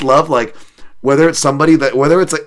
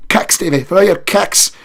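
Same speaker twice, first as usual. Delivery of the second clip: cornerman yelling; 10 semitones higher, 5 dB louder, slower than usual. Retold or imitated. Repeated cornerman shout.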